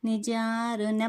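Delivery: slow, careful recital of the full name; slow model recitation. Shown as a demonstration. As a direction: recite it slowly so each stretched and stressed syllable is clear. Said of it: "బాగా గమనించండి".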